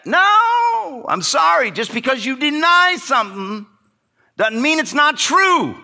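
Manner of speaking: high, loud rebuttal; forceful preaching. Real, not acted. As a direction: hit it hard from the pulpit.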